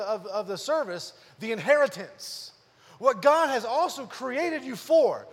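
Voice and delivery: preacher reading scripture aloud with force; preaching scripture reading. Acted. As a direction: proclaim the verse to the congregation.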